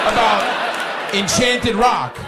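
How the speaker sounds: slow, stretched, laughing outrage on "about Enchanted Rock"; drawn-out mock indignation. Real, not acted. Host mocking guest's earlier snippiness, stretched indignant drawl. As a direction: drag out "Enchanted Rock" with sarcastic disbelief.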